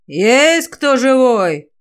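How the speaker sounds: searching shout, vowels stretched; calling out. A narrator's reading. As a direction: call out for survivors, slow and carrying. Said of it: "крикнул Давир".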